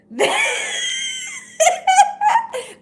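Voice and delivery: high wailing laugh near tears; shrieking laugh. Real, not acted. Reacting to Sonia's story with a high crying laugh. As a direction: laugh until it almost cries.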